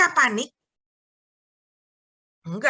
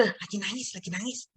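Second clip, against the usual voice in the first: hushed, low imitated aside; discreet signal; 6 semitones lower, 11 dB quieter than usual. Mother secretly warns that her child is crying, hushed. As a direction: pass it on quietly, under your breath. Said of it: "Adik saya kasih kode".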